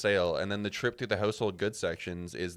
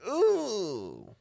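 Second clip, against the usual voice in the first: high mock ooh; 13 semitones higher, 3 dB louder than usual. Reacting to pan price.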